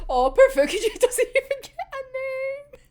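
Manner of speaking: high, laughing mock pity; high-pitched laughing sympathy; laughing quote. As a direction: giggle through the pity. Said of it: "I was like".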